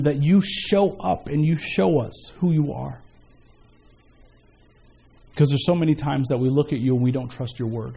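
low, earnest spoken prayer. Real, not acted. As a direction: pray aloud with pauses between phrases. Leads a prayer.

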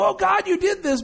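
high-pitched wail of blame at God; put-on yelling complaint, pitched high; mock yell. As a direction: cry out in mock protest, pitch leaping up. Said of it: "we can yell and scream and go".

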